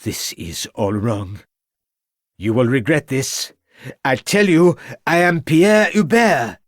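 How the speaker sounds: put-on desperate prisoner in a French accent, rising high. A narrator's reading. Prisoner to inspector, desperate fake French accent. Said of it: "raising his voice in desperation".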